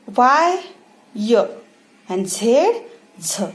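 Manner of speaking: letter name वाई lifted, then short sound य; name वाई and जेड, answer each with its short sound य, झ; teacher's measured letter-and-sound recital; phonics drill. Shown as a demonstration. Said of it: "ये सारे phonic sounds है".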